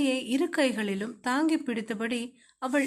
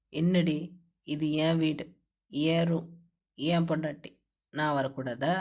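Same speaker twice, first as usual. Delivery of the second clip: teasing husband; 9 semitones lower than usual. A narrator's reading. Husband teasing, approaching her.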